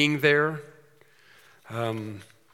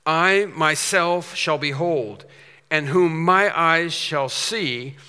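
slow, weighty recitation; grave, drawn-out preaching of Job; solemn scripture reading; 3 semitones higher, 4 dB louder, much slower than usual. Acted. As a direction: read it slow like holy writ. Reciting Job's words.

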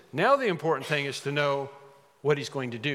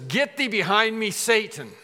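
loud, higher-pitched command; acted rebuke; 6 semitones higher, 4 dB louder than usual. The Lord turns to Peter before the disciples, forceful rebuke.

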